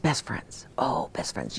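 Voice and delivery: soft fond whisper, fading. Real, not acted. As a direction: breathe it out like a treasured memory. Fond memory of her best friend.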